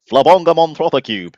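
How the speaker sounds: loud, high, badly imitated Mancunian accent; terrible Mancunian accent. Host imitating his friend naming the show, bad Mancunian accent. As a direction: blurt it out in a hammed-up Mancunian accent. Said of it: "That was my terrible accent".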